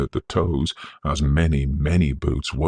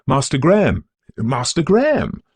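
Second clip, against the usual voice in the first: high, loud calls of the name, out of breath; breathless exclaiming; 10 semitones higher, 5 dB louder than usual. A narrator's reading. Old servant running after young master, breathless shouts. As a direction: call after him, panting as you run. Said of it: "this man exclaimed breathlessly".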